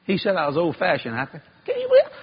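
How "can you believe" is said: squeaked high exclamation; incredulous cry; outraged disbelief. Real, not acted. Telling the crowd about the pastor, squeaking in high disbelief.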